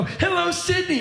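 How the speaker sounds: high arena hello; rock star greeting. Pretend. Comedian mocking the rock-star greeting to his audience. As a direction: call it out like a big rock act. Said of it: "if I go".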